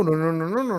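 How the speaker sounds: ordinary talk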